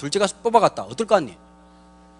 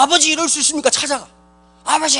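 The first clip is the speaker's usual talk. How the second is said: angry son; 6 semitones higher, 3 dB louder than usual. Retold or imitated. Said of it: "뚜껑 열려가지고".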